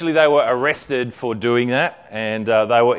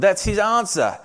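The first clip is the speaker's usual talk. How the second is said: eager; 7 semitones higher than usual. A narrator's reading. Betsy eager revelation.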